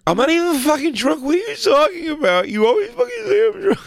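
put-on high, shrill drunk girlfriend rant; drunk yelling outside. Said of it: "[name]'s gonna go".

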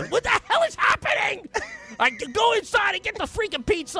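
frantic exasperation. Retold or imitated. Dad rushing to grab pizza while son is sick, frantic. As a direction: rush the words, frantic.

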